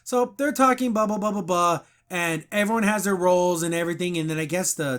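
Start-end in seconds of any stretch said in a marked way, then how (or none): none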